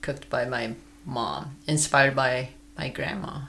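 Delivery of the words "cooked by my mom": soft whispered aside. Real, not acted. Daughter whispering that her mom cooked, hushed. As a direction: whisper it like a little secret.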